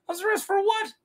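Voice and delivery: high, incredulous pitch on arrest; baffled question. Pretend. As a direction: demand the reason in disbelief.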